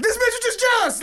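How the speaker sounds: high, loud burst of mock outrage. Telling the story, mocking the jealous friend, high and loud. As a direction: shout the jab high and loud. Retelling inner reaction to friend.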